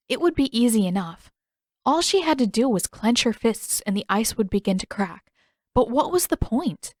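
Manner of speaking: plain reading voice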